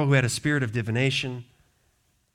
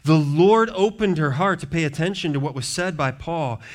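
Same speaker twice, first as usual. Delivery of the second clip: usual speaking voice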